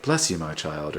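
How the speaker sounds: soft, low blessing; imitated Jesus statue. Mini-golf Jesus after hole in one, soft blessing. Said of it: "It's like".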